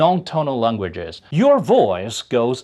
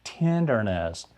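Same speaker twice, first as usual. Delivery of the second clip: tender; 5 semitones lower, 8 dB quieter than usual. Shown as a demonstration.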